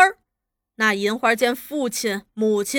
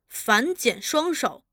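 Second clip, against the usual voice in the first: ordinary delivery, nothing marked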